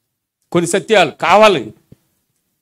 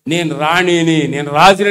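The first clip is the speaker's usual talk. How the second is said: slow నేను రాణిని, నేను రాజుని, weight on రాణిని; with conviction; 3 semitones lower, slower than usual. Shown as a demonstration. Preacher showing how to claim it, slow and convinced. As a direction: say it slowly and mean it. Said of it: "అని గట్టిగా నిజంగా చెప్పాలి".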